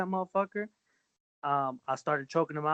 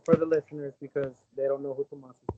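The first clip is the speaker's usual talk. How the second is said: normal talking voice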